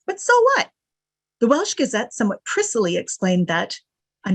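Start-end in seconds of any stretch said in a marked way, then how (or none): 0.1-0.7 dismissive, much higher and louder; scoffing. Retold or imitated. Newspaper brushing off Cardiff's wealth, scoffing. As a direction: toss the question off with contempt. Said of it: "the paper scoffed".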